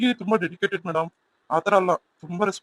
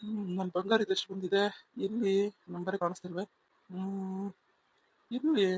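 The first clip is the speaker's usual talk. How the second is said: soft, absorbed mutter; murmuring to himself; 7 dB quieter than usual. Acted. Teacher secretly looking for sweetheart's result, murmuring. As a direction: think aloud under your breath.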